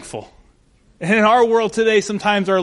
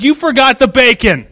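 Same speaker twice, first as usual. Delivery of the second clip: louder, higher mock-scolding wife; punchline; 5 semitones higher, 9 dB louder than usual. Wife scolding husband over his sundae, louder mock scold. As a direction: land the joke with a raised scold.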